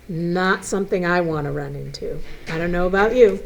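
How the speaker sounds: ordinary delivery, nothing marked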